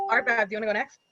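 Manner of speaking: ordinary talk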